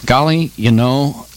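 usual speaking voice

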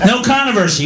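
usual speaking voice

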